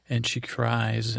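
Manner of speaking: ordinary delivery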